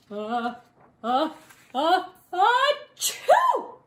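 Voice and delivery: uh, uh, uh building into a loud high chew; building sneeze. A narrator's reading.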